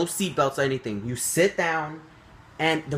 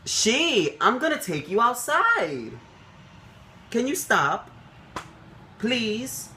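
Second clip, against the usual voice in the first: higher, exasperated coaxing; mock-stern then begging pet talk; pleading with the dog; 9 semitones higher, slower than usual. Real, not acted. Scolding dog during the video.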